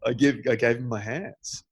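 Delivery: normal talking voice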